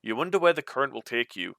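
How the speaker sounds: ordinary talk, nothing marked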